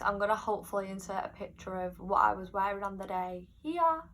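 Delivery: ordinary delivery, nothing marked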